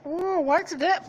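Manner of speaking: high, slow squeaky wondering; curious toad. Acted. Toad picking up the shotgun, high curious squeak. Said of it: "another one picks up the shotgun and says".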